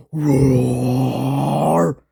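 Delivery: monster roar. Acted.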